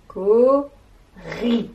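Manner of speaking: co-ri said loud with a break between co and ri; syllables split. Shown as a demonstration. Teacher repeating the word for the children. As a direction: tap out co-ri syllable by syllable. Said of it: "dans la deuxième syllabe".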